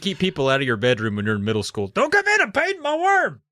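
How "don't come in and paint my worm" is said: mock kid yell. Mock kid yelling at intruders.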